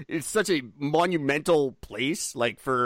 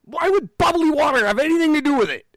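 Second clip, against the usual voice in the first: angry rant; 11 semitones higher, 4 dB louder than usual. Real, not acted. Ranting about tonic water claims.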